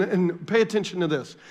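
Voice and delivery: normal talking voice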